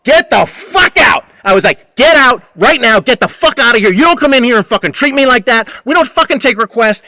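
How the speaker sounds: retold angry yell at the customer; yelling him out. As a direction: bark the order to leave. Narrator throws Butch out.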